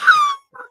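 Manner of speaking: loud squealing laugh; high burst of laughter. Real, not acted. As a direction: break into a shrieking laugh. Cracking up at own story.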